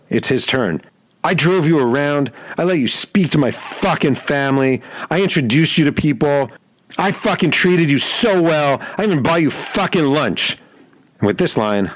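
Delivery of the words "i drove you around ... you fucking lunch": high, slowing, hammed-up fury; high-pitched furious rant; imitating furious rant. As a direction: play the betrayed athlete seething. Rocker ranting.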